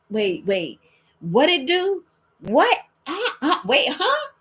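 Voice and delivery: rising, flustered wait what huh; confused double take. Retold or imitated. Listener hearing about minerals, confused double take. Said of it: "You be like".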